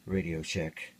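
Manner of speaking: ordinary talk, nothing marked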